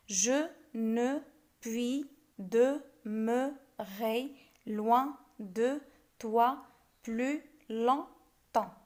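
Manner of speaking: "Je ne puis demeurer loin de toi plus longtemps" in very slow, detached syllables; scanning "Je ne puis demeurer loin de toi plus longtemps" one beat at a time. Shown as a demonstration. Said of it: "On fait de même".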